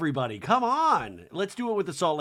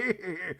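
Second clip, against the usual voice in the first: soft put-on titter; imitated giggle; 3 semitones higher, 6 dB quieter than usual. Meredith giggling on stage, soft mock giggle. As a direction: mimic someone giggling. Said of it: "Meredith's giggling".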